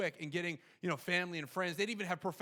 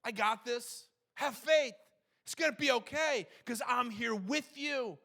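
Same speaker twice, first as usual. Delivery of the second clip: higher, building in force; mildly raised preacher voice, quoting Jesus; building reassurance; 7 semitones higher, 4 dB louder, slower than usual. Retold or imitated. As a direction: encourage with rising conviction. Reassurance builds toward congregation.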